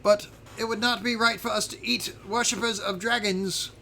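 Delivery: high, earnest creature voice; little creature's voice. Pretend. Little creature refusing to eat dragon worshippers, high earnest voice. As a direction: object primly in a high character voice. Game master answering as the small creatures.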